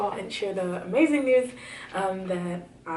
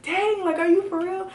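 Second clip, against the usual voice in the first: much higher, mock astonished; lightly voiced disbelief; surprised friends; 13 semitones higher, 5 dB louder than usual. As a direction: play the shocked friend. Friends react to announcement.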